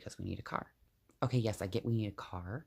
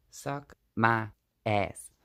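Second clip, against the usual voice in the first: slow, louder, each word spelled out; defiant; 7 dB louder, much slower than usual. Real, not acted. Snapping at online critics, slow and louder defiance. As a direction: drag it out to tell the haters off.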